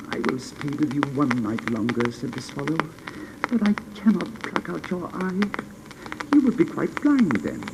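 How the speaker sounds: high, bright-voiced bird speaking; swallow, eager little bird. Swallow explaining why it must fly to Egypt, high. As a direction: chirp it out eagerly.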